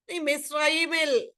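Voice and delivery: ordinary talk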